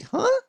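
"Huh?" leaps very high at the end; rising "Huh?". Shown as a demonstration. Pastor demonstrating a question rise on a single word. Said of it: "raise your, the last note".

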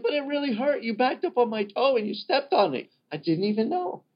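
high, whiny, wounded protest; hurt complaint. Retold or imitated. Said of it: "And you're like".

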